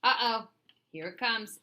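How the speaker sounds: ordinary delivery, nothing marked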